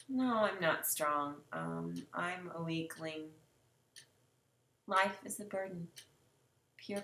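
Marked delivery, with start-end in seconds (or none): none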